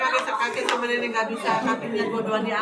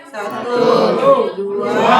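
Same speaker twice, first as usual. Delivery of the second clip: loud group count in unison; counting workout reps; 12 dB louder, much slower than usual. Real, not acted. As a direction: shout each rep number together. Instructor starts group count. (hitung repetisi bersama dengan keras)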